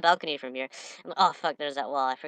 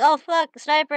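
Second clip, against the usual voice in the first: much higher, louder, startled cry; startled yelp; 10 semitones higher, 5 dB louder than usual. Retold or imitated. Gamer retells spotting a sniper, startled shout. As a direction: jolt as the sniper appears. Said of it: "I'm like".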